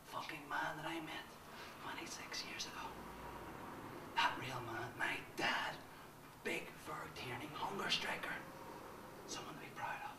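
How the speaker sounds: soft awed whisper; reverent whisper. Acted. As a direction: whisper with pride. Reverent whisper about father.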